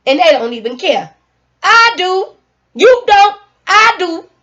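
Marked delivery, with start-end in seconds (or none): 1.6-4.3 mock back-and-forth.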